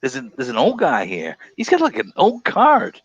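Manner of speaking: mock shop clerk. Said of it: "What is this card".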